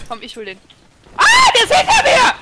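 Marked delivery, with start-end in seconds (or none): 1.2-2.3 panicked laughing shout; panicking gamer. Real, not acted. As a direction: scream in sudden panic. Enemy suddenly behind him.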